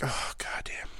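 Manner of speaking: hushed, whispered groan of a curse; whispered dismay. Retold or imitated. Reacting to old memories, whispered dismay. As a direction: let it out under the breath.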